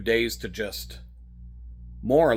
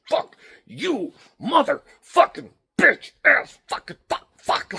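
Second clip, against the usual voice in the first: high, loud cursing, straining at the oars; angry rowing curses; 13 semitones higher, 4 dB louder than usual. Retold or imitated. Cursing at the rowing machine during a hard interval, high and loud. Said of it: "angry rowing interval number five".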